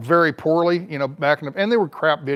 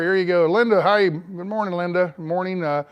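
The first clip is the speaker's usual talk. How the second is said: ordinary delivery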